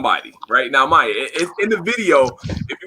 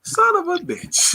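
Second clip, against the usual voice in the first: dismayed curse; slower than usual. Retold or imitated. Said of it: "He like".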